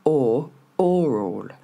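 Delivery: slow O alone, then ORAL as "OR-ol"; teacher's drawn-out "O... oral", stress up front; first syllable isolated. Shown as a demonstration. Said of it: "je marque bien l'accent tonique sur la première syllabe".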